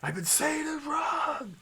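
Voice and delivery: high, laughing shout of realization. Real, not acted. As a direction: yell it out, laughing at yourself. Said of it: "realizes own mistake".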